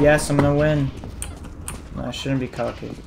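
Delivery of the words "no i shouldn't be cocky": normal talking voice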